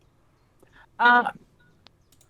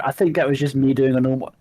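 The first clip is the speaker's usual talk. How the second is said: normal talking voice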